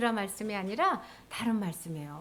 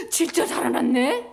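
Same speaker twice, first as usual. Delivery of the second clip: loud, gasping amazement; acted shock with big loud wows; astonished disciples; 3 semitones higher, 5 dB louder, slower than usual. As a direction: gasp and exclaim in wonder. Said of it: "제자들이 깜짝 놀랐어".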